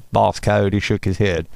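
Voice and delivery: plain reading voice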